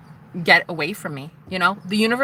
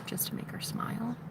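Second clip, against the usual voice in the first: whisper; 5 semitones higher, 14 dB quieter than usual. Real, not acted. Telling the camera about the man in front, whispering.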